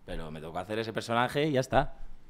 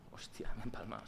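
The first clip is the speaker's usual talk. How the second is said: hushed, voiceless aside; embarrassed whisper; 7 dB quieter than usual. Acted. Actor recalling his awkward scene, embarrassed whisper. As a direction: whisper it like a private dismayed thought. Acting out an embarrassed inner thought.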